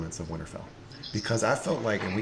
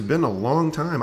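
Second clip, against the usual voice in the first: slower, stretched and a little higher; drawn-out repeat; 7 semitones higher, 4 dB louder, slower than usual. Real, not acted. Host echoing his welcome to Spencer, slow and drawn out. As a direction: savour each word of the greeting.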